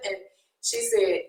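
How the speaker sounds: ordinary delivery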